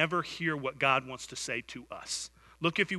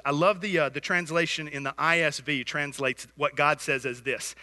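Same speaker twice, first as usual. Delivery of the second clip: usual speaking voice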